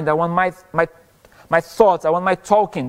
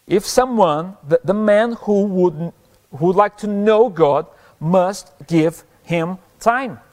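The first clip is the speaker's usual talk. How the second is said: slow, weighty sermon reading; deliberate, declaimed quotation with long pauses; emphatic quote reading; 3 semitones higher, slower than usual. Acted. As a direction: read it out slowly, driving key words home.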